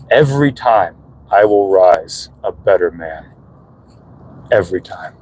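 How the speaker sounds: low, grave vow with pauses; deep, measured, solemn delivery; solemn motto. Acted. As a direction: drop low and declare it like a creed. Solemnly quotes own motto as answer.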